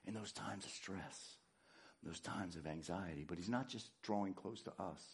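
low, hushed preaching; soft, intimate, spaced-out phrases; whispered reverence. Shown as a demonstration. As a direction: draw them in with a near-whisper.

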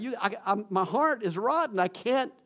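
retold desperate prayer, high and straining; anguished plea. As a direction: cry out to God like a lost young man at the end of his rope. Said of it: "I told God that".

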